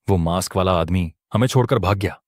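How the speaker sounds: usual speaking voice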